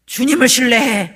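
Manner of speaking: loud, emphatic mock parental command; preachy answer-giving. Parent handing an anxious child an answer, loud mock exhortation. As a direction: cut in forcefully with the ready answer. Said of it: "막 이러면서 막 답을 주세요".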